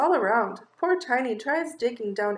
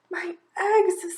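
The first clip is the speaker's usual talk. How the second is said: sighing; 7 semitones higher than usual. Acted. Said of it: "sighs poor Fluff".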